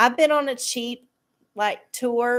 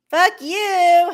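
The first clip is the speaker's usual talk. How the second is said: playful shouted fuck you, higher and louder; mock insult; 7 semitones higher, 8 dB louder than usual. Vlogger jokingly yelling at a chat friend, loud and playful. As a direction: tease a friend with a fake cuss.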